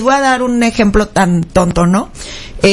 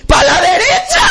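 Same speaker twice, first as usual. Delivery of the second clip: higher, emphatic punchline cry; comic exclamation; 4 semitones higher than usual. Retold or imitated. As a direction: belt it out as a laughing confession. Punchline of the driving story. (exclamación cómica, más aguda)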